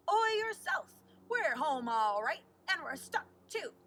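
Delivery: pirate captain. A narrator's reading. Pirate captain answers the boat from his rail, hearty. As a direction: bellow back like a cranky pirate captain. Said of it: "said the captain, Long John Silver".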